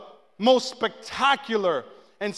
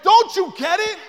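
emphatic; 9 semitones higher, 6 dB louder than usual. Real, not acted. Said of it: "preacher pressing congregation".

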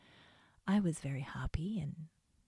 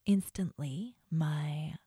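normal talking voice

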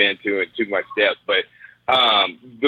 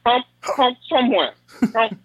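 mock taunting grunts; 11 semitones higher than usual. Mocking voice, high and odd.

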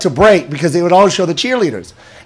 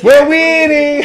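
Filipino aunt voice, higher; Filipino auntie accent; 4 semitones higher, 8 dB louder, much slower than usual. Retold or imitated.

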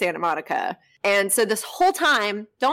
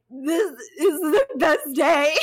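teary stoned joy; 6 semitones higher, slower than usual. Retold or imitated. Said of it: "I cried about that".

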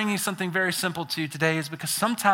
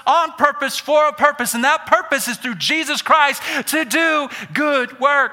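rising to a full preaching shout, higher and louder; 6 semitones higher, 5 dB louder than usual. Real, not acted. Pastor shouting the point to the church. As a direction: build the sermon to a shout.

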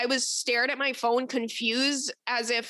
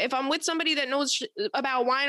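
ordinary talk

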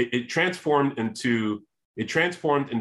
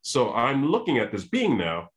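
normal talking voice

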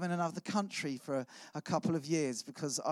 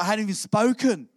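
louder, higher, affronted outburst; indignant protest; 5 semitones higher, 8 dB louder than usual. Retold or imitated. Newcomer scolded on the church stairs, loudly indignant. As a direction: protest it loudly, as if wrongly told off.

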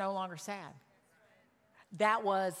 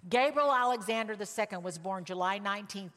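ordinary talk, nothing marked

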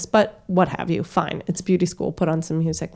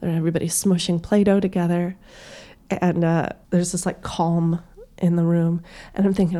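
ordinary talk

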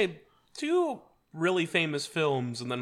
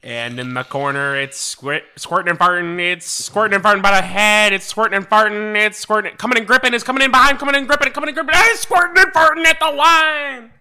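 race caller; 5 semitones higher, 10 dB louder than usual. Retold or imitated. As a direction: race call building to a high, loud finish. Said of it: "the world's greatest horse race collar".

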